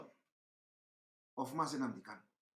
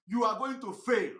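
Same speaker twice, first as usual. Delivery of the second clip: usual speaking voice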